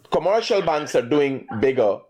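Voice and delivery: normal talking voice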